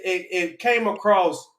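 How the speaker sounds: normal talking voice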